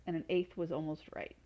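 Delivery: ordinary delivery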